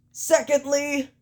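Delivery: high, pompous promo opener; Matt Jackson. Acted. Wrestler takes over the microphone, bad Matt Jackson impersonation. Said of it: "I can't do a good Matt Jackson imperson".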